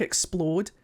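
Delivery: ordinary talk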